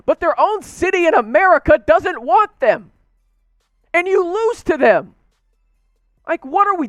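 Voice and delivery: can you believe this, rising high; incredulous outburst. Real, not acted. Fan mocking the loss to Jacksonville, incredulous high-pitched disbelief.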